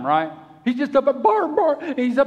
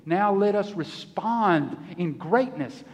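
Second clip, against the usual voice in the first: usual speaking voice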